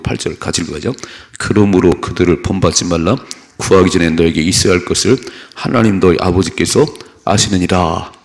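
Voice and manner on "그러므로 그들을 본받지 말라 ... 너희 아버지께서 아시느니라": scripture reading. Acted. Preacher reading Matthew aloud, loud and measured. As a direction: read it out to the congregation.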